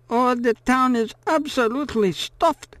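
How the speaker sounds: put-on high old woman's drawl; fairy tale drawl. A narrator's reading. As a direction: chatter on like a storybook old woman. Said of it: "in her fairy tale drawl".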